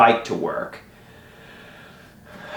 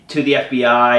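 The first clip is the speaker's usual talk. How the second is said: ordinary talk, nothing marked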